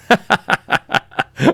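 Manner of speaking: high-pitched laugh at himself; a squeaky self-mocking laugh. Real, not acted. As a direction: burst into a high giggle. Laughing at own story.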